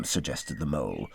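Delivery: usual speaking voice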